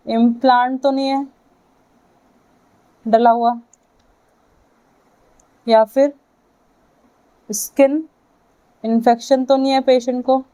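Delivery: normal talking voice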